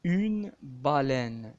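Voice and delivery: Une baleine, stretched right out; very slow demo. Shown as a demonstration. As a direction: drag each syllable of baleine. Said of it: "تتقرا اي كذلك".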